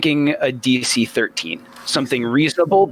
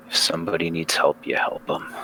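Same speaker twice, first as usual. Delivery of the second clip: low, soft, half-whispered resolve; under his breath; 9 semitones lower, 5 dB quieter than usual. Acted. Jeremiah muttering his resolve to the party, low and hushed. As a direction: mutter it to yourself, almost unvoiced. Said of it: "say under his breath".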